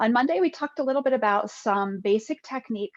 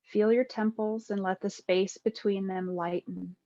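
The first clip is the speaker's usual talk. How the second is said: guided meditation; 4 dB quieter than usual. Acted. Yoga teacher guiding listeners lying with eyes closed, calm meditation voice. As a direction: ease them into stillness, phrase by unhurried phrase.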